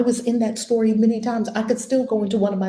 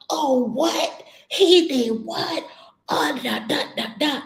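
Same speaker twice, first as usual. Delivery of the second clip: high, stretched-out mock disbelief; mock outraged shock; 8 semitones higher, slower than usual. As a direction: ham up the mind jumping in shock. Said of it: "the mind is gonna jump up".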